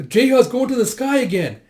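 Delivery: high, loud alert; alarm shout. Acted. Villagers spot Jeha fleeing, alarmed shout.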